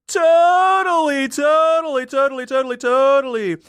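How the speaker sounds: high, loud, stretched surfer-dude voice; dumb surfer. Acted. Ventriloquist assures police the dead man surfs, dumb surfer voice. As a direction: overdo the beach bum, voice way up. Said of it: "like he's some dumb surfer".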